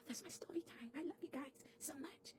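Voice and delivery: soft unvoiced sign-off whisper. Real, not acted. Vlogger whispers her love to her viewers as she signs off. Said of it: "signing off in a whisper".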